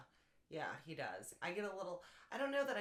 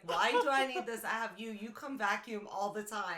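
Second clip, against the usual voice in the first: louder mock husband whine; husband teasing his wife, louder mock whine; 4 semitones higher, 8 dB louder than usual. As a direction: play up the husband's protest. Husband quoted mocking.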